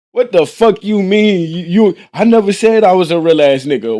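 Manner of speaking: heated mock outrage; angry disbelief. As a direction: throw his words back at him. Retelling confronting the homie.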